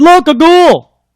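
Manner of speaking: high, loud, slow frightened shriek; girls' frightened cry. Acted. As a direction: shriek like scared little girls. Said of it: "The girls all cried".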